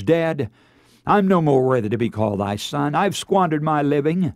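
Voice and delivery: ordinary talk, nothing marked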